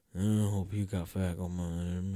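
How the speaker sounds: low, slow groggy mumble; groggy grumble. Retold or imitated. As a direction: drag it out like a zombie before coffee. Grumpy uncaffeinated reply to friends.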